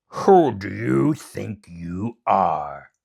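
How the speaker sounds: loud, very slow sneer; menacing demon king. Acted. Demon king challenges Momotaro, slow loud sneer. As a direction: loom over them with scorn. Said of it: "said the king".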